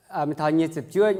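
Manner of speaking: normal talking voice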